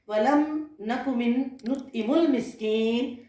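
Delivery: slow, measured Arabic recitation; Quran recitation. Acted. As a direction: draw out the verse as scripture. Quran verse recited in the sermon.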